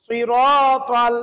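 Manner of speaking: সিরাতাল drawn out very slowly; slow recitation. Shown as a demonstration. Quran teacher models সিরাতাল slowly for learners. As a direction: stretch সিরাতাল slowly, syllable by syllable. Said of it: "এখানে আমাদের এমনি হয়ে যায়".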